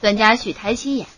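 ordinary delivery

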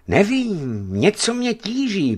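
high, plaintive sigh. A narrator's reading. Restless tentmate confiding at night, sighing.

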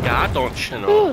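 hammed-up Upper Midwest accent; Wisconsin accent. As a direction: echo it like a Wisconsin local, stretching "know". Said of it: "had that accent".

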